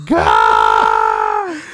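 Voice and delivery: low, loud groaned God; floored by the answer; stunned exclamation. Retold or imitated. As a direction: son reacting to dad's story, groaning. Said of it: "And I'm like".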